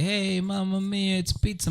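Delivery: mock Italian accent. Organizers suggesting the pizza announcement, in mock Italian. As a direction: lightly imitate a stock Italian accent. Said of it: "the whole Italian thing".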